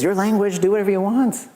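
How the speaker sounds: a hint of a kindly old man; friendly elder quoted; retired helper. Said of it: "And he says".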